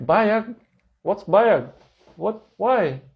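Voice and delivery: loud, bewildered foreigner asking again and again; loud confused questions. Retold or imitated. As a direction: keep asking loudly, baffled. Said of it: "malakas yung boses ko rin".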